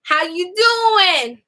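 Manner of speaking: high, drawn-out mock New York greeting; New York accent. Shown as a demonstration. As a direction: imitate a New Yorker, stretching it slowly. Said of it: "It's like going to New York".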